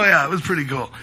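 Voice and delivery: ordinary talk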